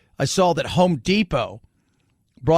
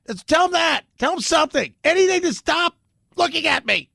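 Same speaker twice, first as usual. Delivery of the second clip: mocking frantic voice; 9 semitones higher than usual. Mocking the administration's frantic message.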